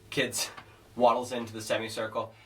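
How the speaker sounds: ordinary talk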